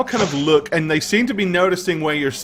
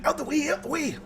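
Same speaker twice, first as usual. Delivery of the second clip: high, softer squeaky dwarf voice; scurrying little dwarf; 13 semitones higher, 7 dB quieter than usual. Acted. Dwarf shoving past two others to reach the corner, small high voice. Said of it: "nuzzling himself past the other two dwarves".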